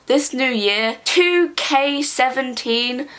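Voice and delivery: usual speaking voice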